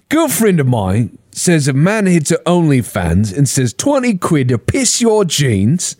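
mock northern English bloke telling a story; imitating Chippy. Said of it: "He's like".

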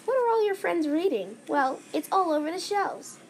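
upbeat ad pitch; commercial voice. Pretend. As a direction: sell it like a TV ad. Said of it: "making up a commercial for their topic".

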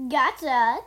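loud, punchy exclamation; teasing gotcha. A narrator's reading. Cole teases the fooled villain, loud gotcha. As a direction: crow it at the one fooled. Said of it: "Cole added the chuckle".

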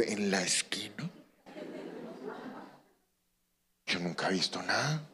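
hushed, unvoiced whisper of disbelief. Preacher whispers in disbelief at a crime near his own home, whispered. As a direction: breathe it like a secret. Surprised reader noticing the street.

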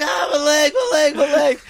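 high, loud shouted cry of pain; mock pain cry. Shot mate crying out over his bleeding leg, high shout. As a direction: wail over the wounded leg. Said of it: "He's like".

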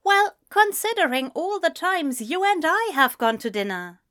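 high-pitched reasoning in character; pointed, reasonable argument; earnest, prim friend. A narrator's reading.

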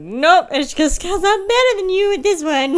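high, loud playful taunt; mock gloating. Player telling boyfriend she's just better, mock gloating. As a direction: crow it teasingly over the loser.

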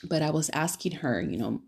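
ordinary delivery

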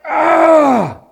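loud, high mock snarl with bared teeth; enraged teeth-baring snarl. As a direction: snarl like someone bearing their teeth in rage. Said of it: "They're, they're like".

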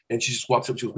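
ordinary talk

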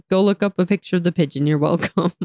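ordinary talk

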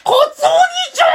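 very high, fast squeal; cartoon little-sister impression. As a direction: shriek for big brother in falsetto. (甲高い妹キャラのモノマネ)

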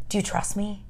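soft, tender question; hushed and reassuring; gentle invitation. Acted. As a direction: ask it very softly, as an invitation. God gently inviting, voiced.

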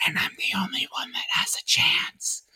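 breathy secret whisper. Acted. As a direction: hush it like a secret. Whispered joke.

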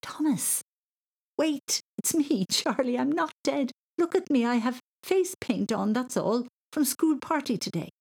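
high, insistent pleading to listen; urgent explaining. Acted. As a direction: break through someone's panic. Sister urgently calming panicking boy.

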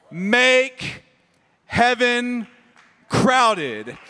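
each word punched, loud and spaced; emphatic slogan. Real, not acted. Pastor driving home the church slogan, emphatic. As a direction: hit every word of the slogan. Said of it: "slogan punched word by word".

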